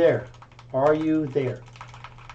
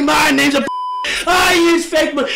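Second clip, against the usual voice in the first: taunting shout; 2 semitones higher than usual. Real, not acted. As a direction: shout the taunt right at him.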